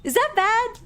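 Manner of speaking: high squeaky worry; worried squeak. Real, not acted. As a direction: squeak the question nervously. Worried about bad ending.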